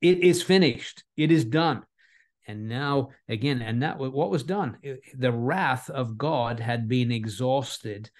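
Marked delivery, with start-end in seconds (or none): 0.0-1.8 higher and louder, a ringing proclamation; crying out. Retold or imitated. Jesus on the cross crying out, high and loud. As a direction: cry it out like a triumphant last word. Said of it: "he cried out".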